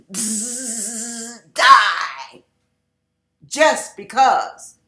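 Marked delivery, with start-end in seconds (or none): 0.1-1.5 quiet buzzing spray noise; spray hiss. Pretend. Imitates spraying Raid. 1.5-2.4 sudden loud shouted kill word; mock yell. Killing the roach.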